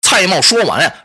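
ordinary delivery

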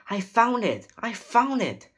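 loud, very high exclamation; excited cry. A narrator's reading. Boy finding the treasure, crying out excitedly. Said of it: "cried Jack".

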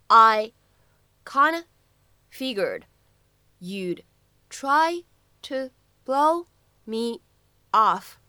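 each word apart, slowly, "I" and "off" punched loud; slow teaching drill, word by word. Shown as a demonstration. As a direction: break it into single words for students. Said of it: "发音技巧".

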